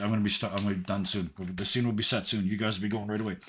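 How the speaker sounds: ordinary talk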